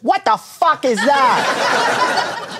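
high, drawn-out mock Indonesian outburst; slow, baffled Indonesian caricature, pitched high; bad Indonesian accent. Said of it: "forgive me for this bad Indonesian accent".

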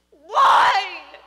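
very loud, high anguished wail; sobbing cry. A narrator's reading. Father asking about daughter's death, sobbing. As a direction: wail it out in grief. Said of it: "he was sobbing".

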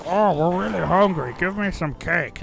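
high, put-on zombie voice, hammed up; hungry zombies. Zombies begging the gamer for cake, hammed-up hungry voice. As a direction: whine it as a comic zombie. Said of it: "the zombies were like".

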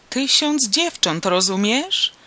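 high, loud, drawn-out exclamation; shrieking. A narrator's reading. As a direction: yell it slowly, ending on a loud question. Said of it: "wrzasnął". (wysoki, przeciągły wrzask)

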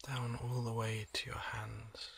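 soft, slow relaxation voice with long pauses; hushed, unhurried body-scan meditation; guided meditation. Acted. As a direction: gently guide the listener into calm, leaving space between phrases.

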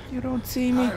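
desperate pleading whimper; panicked plea. Real, not acted. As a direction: voice cracking as it gets closer. Monster is close.

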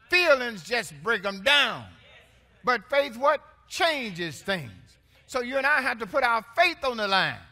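loud, driving preacher's call; full-force preaching. Acted. Pastor preaching loud to his church. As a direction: lift the room with it.

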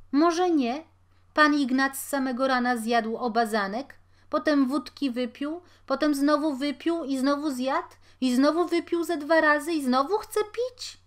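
loud and high, piling up the complaints; indignant outburst. A narrator's reading. Tavern keeper protests Ignacy's tab, loud and high.

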